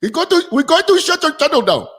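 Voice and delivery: put-on high-pitched caricatured Indian accent; mock Indian accent. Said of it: "that's how they talk".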